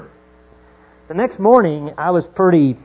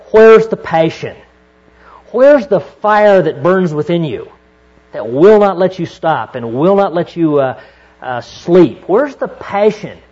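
impassioned preaching; 5 dB louder than usual. Real, not acted. Preaching at full force.

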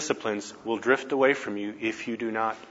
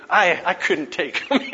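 laughing at himself, slower; 8 semitones higher, 5 dB louder than usual. Retold or imitated. Sizing up the buff guy at the booth, laughing. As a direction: chuckle through the admission.

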